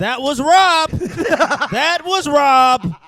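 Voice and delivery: loud laughing denial; protesting. Real, not acted. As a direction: insist it wasn't you. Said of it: "I haven't said shit".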